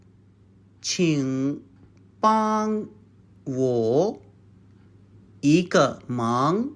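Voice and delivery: 请 帮 我 一个忙 said slowly, one syllable at a time; each syllable apart. Shown as a demonstration. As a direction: space out 请, 帮, 我 like a teacher. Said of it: "you can say".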